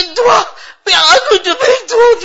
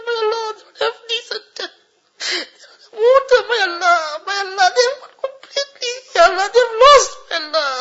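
high, crying prayer; real sobbing supplication, voice pushed high; tearful pleading; 4 semitones higher than usual. Real, not acted. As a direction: weep as you beg God for forgiveness. Weeping supplication for the suffering.

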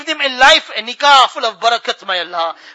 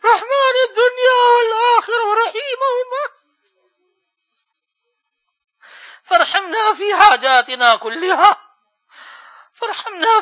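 real tears break the prayer; crying plea; 8 semitones higher, faster than usual. Real, not acted. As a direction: voice breaking high into sobs, fast.

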